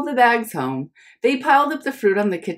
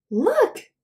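mom insisting; 13 semitones higher than usual. Acted.

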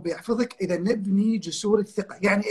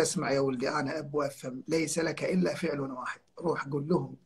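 fatherly softness; 6 semitones lower, 11 dB quieter than usual. Retold or imitated. Fatherly advice to failing student.

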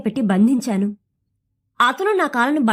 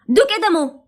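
higher, louder cry in a sudden put-on voice; loud shout; 4 semitones higher than usual. Narrator shouts at the man dragging him to the door. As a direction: yell it to break his grip. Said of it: "గట్టిగా అరిచిన నా మాటకు".

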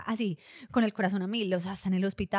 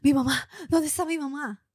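higher, louder anxious search for mom; frantic worry; 6 semitones higher, 6 dB louder than usual. Retold or imitated. Daughter abroad frantically asking where her mother is. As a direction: hunt for your mother in a panic. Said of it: "fui súper".

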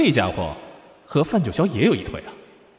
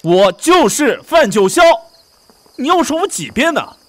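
exasperated shout, climbing higher; angry insistence; 11 semitones higher, 8 dB louder than usual. Acted. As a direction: shout him down, fed up. Insisting angrily, pressed.

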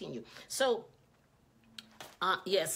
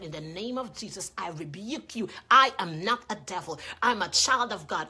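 firm rebuke building much louder; bold declaration; 6 dB louder than usual. Retold or imitated. Little boy rebukes the woman who called him devil, bold. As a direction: declare it boldly, hitting the denial hard. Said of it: "he was bold".

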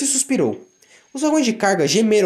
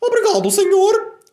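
bem aguda e alta, aliviada; Daisy agradecida; 13 semitones higher, 4 dB louder, slower than usual. Acted. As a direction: agradece com alívio. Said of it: "disse Daisy". (Daisy agradece ao chefe, aguda e aliviada)

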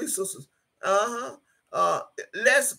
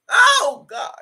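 anguished cry; 9 semitones higher, 6 dB louder than usual. Real, not acted. Overcome with grief for mother.

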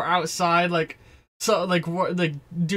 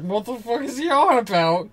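higher-pitched mock bewilderment; baffled reaction; 5 semitones higher, 3 dB louder than usual. Game master as the baffled scientists, incredulous. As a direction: react in exasperated confusion. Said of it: "they're all just like".

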